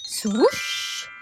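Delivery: ordinary delivery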